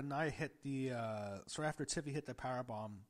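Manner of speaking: usual speaking voice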